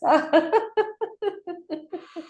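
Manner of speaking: high, giddy laugh; long high-pitched giggle; laughing gas laughter. Shown as a demonstration. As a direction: laugh as if breathing happy gas. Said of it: "it's really laughing, happy gas".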